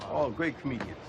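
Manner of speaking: usual speaking voice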